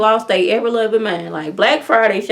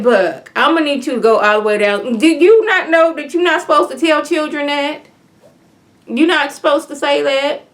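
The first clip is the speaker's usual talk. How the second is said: higher, louder, exasperated telling-off; scolding; 8 semitones higher, 4 dB louder than usual. Retold or imitated. Viewer scolding Buck about Santa, loud and exasperated. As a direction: scold him like a fed-up aunt.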